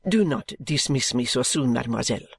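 a hint of a French servant, entreating; pleading French maid. French maid begs mademoiselle not to dismiss her, lightly pleading. Narrator voices applicant pleading.

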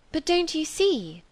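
exasperated appeal; vexed. A narrator's reading. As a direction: plead the point with vexation. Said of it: "still flushed by vexation".

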